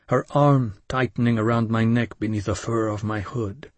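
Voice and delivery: plain reading voice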